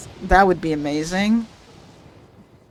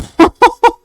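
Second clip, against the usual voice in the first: high, loud joyful laugh; giddy relief laugh; 12 semitones higher, 8 dB louder than usual. Real, not acted. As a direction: burst out laughing in delight. Laughs after winning pull.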